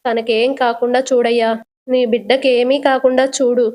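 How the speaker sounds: ordinary delivery, nothing marked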